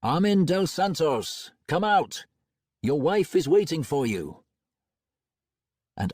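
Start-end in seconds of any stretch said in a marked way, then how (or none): 0.0-4.4 calling out loud and high into the light; a shouted summons into the Dream Time; raised voice. A narrator's reading. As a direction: call him out through the door. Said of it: "I raised my voice and addressed the Dream Time".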